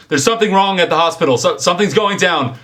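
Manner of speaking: high, loud, urgent screaming; panicked scream. Acted. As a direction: scream the alarm at full pitch. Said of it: "stands up and screams".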